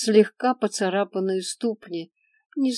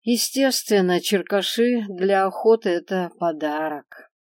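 ordinary delivery, nothing marked

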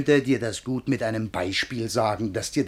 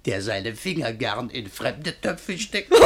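ordinary talk

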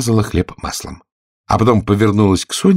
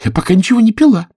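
offended Alice; 14 semitones higher, 5 dB louder, faster than usual. Acted. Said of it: "с обидой".

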